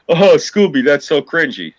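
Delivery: mock-whiny put-down; mocking critic. Mocks critics of his challenge.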